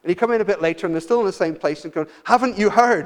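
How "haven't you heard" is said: louder, higher, fed-up parent; exasperated call. Retold or imitated. Parent calling the teenager a second time, exasperated and loud. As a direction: call them again, impatient now.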